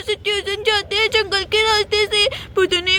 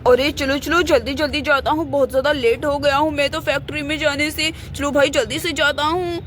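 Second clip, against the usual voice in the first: hurried man; 5 semitones lower than usual. Acted. Hurried man character, low voice.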